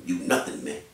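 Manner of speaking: normal talking voice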